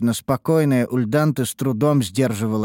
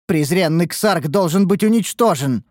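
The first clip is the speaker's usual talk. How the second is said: higher-pitched burst of loathing; spoken with seething, raised-pitch hatred; hateful outburst; 7 semitones higher, 4 dB louder than usual. A narrator's reading. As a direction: lose control with hatred. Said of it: "потеряла самообладание от раздирающей её ненависти".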